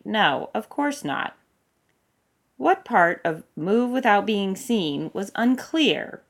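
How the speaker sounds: mock the order, drawn out; sarcastic rant. A narrator's reading. Aramis mocking the order to move unseen.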